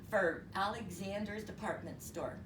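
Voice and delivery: plain reading voice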